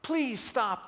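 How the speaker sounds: high, loud, anguished begging; acted tearful outcry; sobbing plea. A narrator's reading. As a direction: plead desperately like a guilt-stricken boy. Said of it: "the boy sobs".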